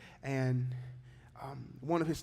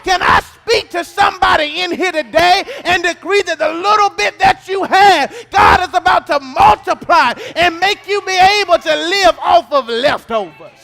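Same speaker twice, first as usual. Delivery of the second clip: rising, much louder sung preaching cadence over music; full-force preaching; 11 semitones higher, 18 dB louder than usual. Acted. Pastor preaching to the congregation, sung and shouted.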